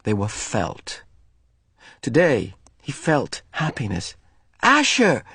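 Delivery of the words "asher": high called name across a field; bright shout across the playground; calling out. Acted. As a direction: call his friend from afar. Calling out to friend.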